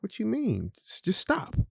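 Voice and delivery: high, softened disbelief; retold baffled question, pitched well up; puzzled parent, high and light; baffled dad. Baffled father answers his daughter.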